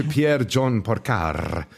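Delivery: "Pierre John Porcar" drawn out slowly with rolled R's. Shown as a demonstration. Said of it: "I'm gonna roll my R's".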